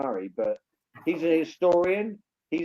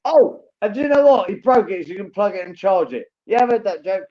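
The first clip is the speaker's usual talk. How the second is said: much higher and louder, gleeful; excited joke; 6 semitones higher, 10 dB louder than usual. Real, not acted. Co-host jumping in with a joke, excited and loud. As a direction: burst out with the gag.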